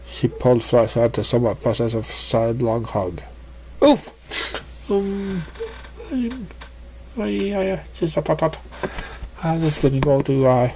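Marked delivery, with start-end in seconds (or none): 3.8-10.7 soft, hesitant stammer; timid squeak. A narrator's reading. Fluttershy squeezed in Pinkie's hug, softly and nervously stammering. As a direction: shrink away nervously. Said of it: "Fluttershy trailed off, squirming".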